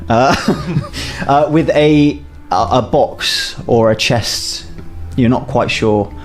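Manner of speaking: ordinary delivery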